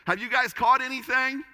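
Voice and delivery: calling out across water. Retold or imitated. Jesus calling from shore to the fishermen, hailing loud. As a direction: shout it out to a boat offshore. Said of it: "this figure yells out to the guys in the boat".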